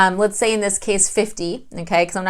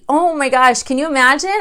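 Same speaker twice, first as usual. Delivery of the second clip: usual speaking voice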